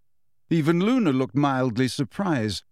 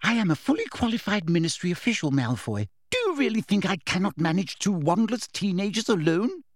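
high, indignant rebuke; sharp; 6 semitones higher than usual. A narrator's reading. Umbridge putting Malfoy in his place, sharp. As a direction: cut him off with official authority. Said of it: "asked Umbridge sharply".

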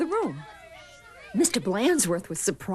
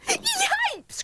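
very high, startled yelp; alarmed cry. A narrator's reading. Kids seeing the dreaded substitute, alarmed cry. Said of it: "cried the kids".

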